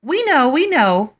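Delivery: 1 nervous high bluff. A narrator's reading. Caught liars bluff nervously.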